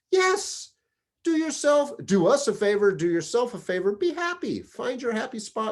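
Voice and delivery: high, loud, excited urging; emphatic retold encouragement. Boss urges battalion chief to be happy, excitedly.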